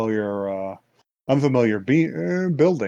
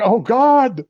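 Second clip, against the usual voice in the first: high, loud shriek of terror; scream of fright; 11 semitones higher, 6 dB louder than usual. Retold or imitated. Schoolgirl spotting a face at the window, frightened shriek.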